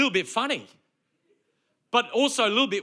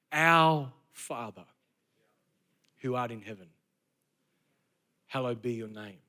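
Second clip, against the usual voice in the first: reverent prayer; 11 semitones lower, 5 dB quieter than usual. Acted. Recites the Lord's Prayer.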